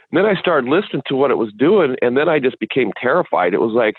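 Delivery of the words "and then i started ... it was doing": ordinary talk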